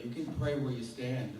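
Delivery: ordinary delivery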